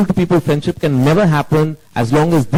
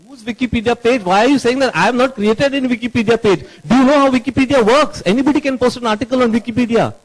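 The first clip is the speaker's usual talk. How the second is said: higher, irritated protest; exasperated denial; 7 semitones higher than usual. Real, not acted. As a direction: scoff at the accusation, pitched up. Director denies making Wikipedia page.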